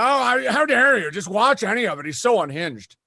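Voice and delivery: mock outrage. Mocking outraged critics.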